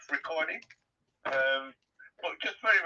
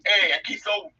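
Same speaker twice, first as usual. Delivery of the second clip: mock rowdy posh toff; 7 semitones higher, 8 dB louder than usual. Imitating posh people having fun.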